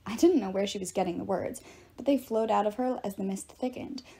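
usual speaking voice

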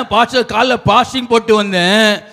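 usual speaking voice

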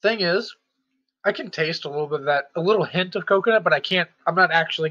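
muffled mouth partly full; talking while chewing. Real, not acted. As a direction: hand covering full mouth. Seen 2: bites at -5; hand covers mouth while chewing, then mouth clears.

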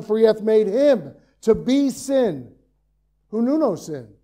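loud, ringing pulpit proclamation; preacher driving the point home to the congregation, loudly; full-force preaching. Acted. Preacher to congregation, loud, ringing proclamation.

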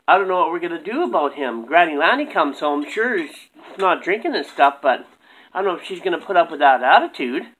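normal talking voice

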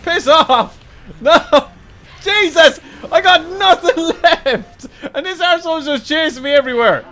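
high, loud, laughing panic; frantic yelling. Real, not acted. Gamer with nothing left being chased, frantic yelling.

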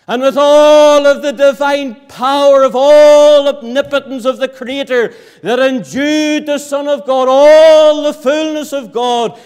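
loud, swelling build to full force; rising preaching climax. Real, not acted.

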